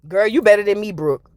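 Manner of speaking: usual speaking voice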